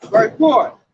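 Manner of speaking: ordinary delivery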